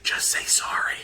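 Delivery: soft, voiceless whispered prompt; imitating a girlfriend whispering; retold whisper. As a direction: whisper it like a discreet nudge in someone's ear. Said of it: "like, whispering in his ear".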